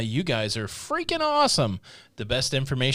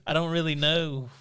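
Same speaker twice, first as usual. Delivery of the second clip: put-on southern accent; 4 semitones higher than usual. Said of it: "burping and doing a southern accent".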